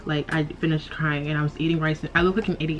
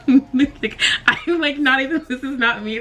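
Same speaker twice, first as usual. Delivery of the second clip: teary laugh; 7 semitones higher than usual. Real, not acted. Embarrassed by tears, laughing to viewers. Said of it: "this is not even who I am".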